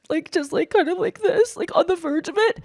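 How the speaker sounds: high, fragile near-tears demo voice; verge of crying. Shown as a demonstration. Showing the teacher's trick, on the verge of crying. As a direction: lift it up as if tears are coming. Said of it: "like you're about to cry".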